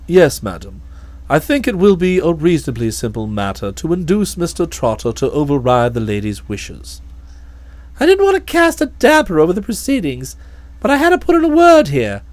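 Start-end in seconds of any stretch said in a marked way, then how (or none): 0.1-7.0 low, calm, deferential butler voice; measured and unruffled, much lower; a low, imperturbable manservant; Jeeves the valet. Acted.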